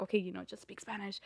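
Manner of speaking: hushed whisper of a scolding voice; mock whisper, barely voiced; whispered quote. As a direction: breathe it out like a whispered aside. Imitating someone telling him.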